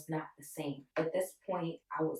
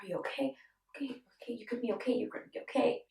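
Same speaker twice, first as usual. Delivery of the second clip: mild panicked reassurance; 6 semitones higher, faster than usual. Retold or imitated. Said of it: "They started panicking".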